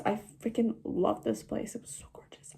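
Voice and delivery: breathy whisper of delight; hushed, happy whisper. Real, not acted. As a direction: whisper it like a secret thrill. Excited whispering to the camera.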